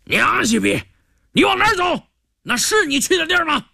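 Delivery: high-pitched, rough-throated scolding; vicious cursing. A narrator's reading. Guard swearing at a prisoner who strays, vicious. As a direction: curse him out like a coarse bully. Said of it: "一个粗嗓门恶声恶气地骂道".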